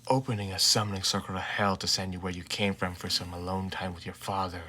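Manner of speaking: lightly voiced weary father, flat and dry; deadpan tired dad. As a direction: grumble it like you can't be bothered. Father Shota answers, deadpan.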